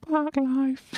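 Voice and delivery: Park life in a put-on Cockney voice, high. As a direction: bark Park life like the record. Imitates song's voice.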